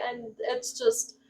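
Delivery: normal talking voice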